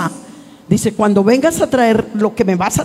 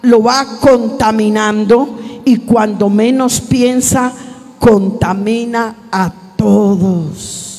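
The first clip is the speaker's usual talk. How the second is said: slow, weighty preaching; slower than usual. Acted. Pastor warning the church about bitterness, slow solemn preaching. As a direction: lean into every phrase, slow and heavy.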